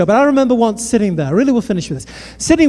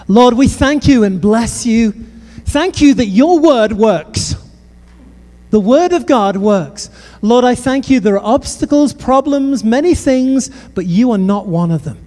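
fervent prayer; 3 semitones higher, 3 dB louder than usual. Acted. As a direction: pray it over the room with force. Closing prayer over the church.

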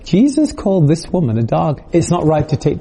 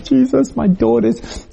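mother's plea; 9 semitones higher than usual. Acted. Mother pleading to Jesus.

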